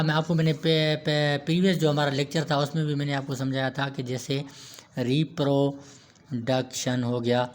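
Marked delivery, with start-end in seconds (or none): 5.0-7.0 रिप्रोडक्शन slowly split as ree-pro, duk-shan; syllable by syllable. Shown as a demonstration. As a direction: break रिप्रोडक्शन into slow, separate chunks. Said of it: "ज़ुबान की many movements के साथ".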